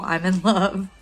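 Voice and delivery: usual speaking voice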